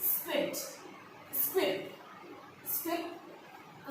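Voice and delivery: Squid said three times in a soft whisper; whispering squid for students to copy. Shown as a demonstration. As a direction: breathe the word squid out with almost no voice. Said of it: "E S Q यू I double P E D".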